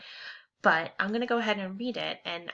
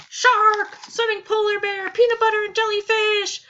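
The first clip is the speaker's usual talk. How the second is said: very high, loud panicked shrieks; excited screaming; 12 dB louder than usual. Acted. Children screaming about sea monsters, very high and loud. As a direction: shriek every scare like a kid. Kids screaming beach scares.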